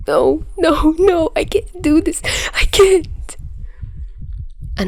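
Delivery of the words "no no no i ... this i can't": high, stumbling, close to tears; words spilling out as he falls apart; tearful panic. Acted. Peter curled behind his door, high tearful panic. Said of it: "Words stumbled from his mouth as tears threatened to fall".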